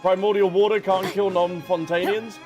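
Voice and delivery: normal talking voice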